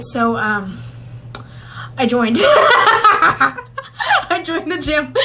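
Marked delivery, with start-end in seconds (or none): 2.4-4.2 laughing. Real, not acted. Laughing about joining gym.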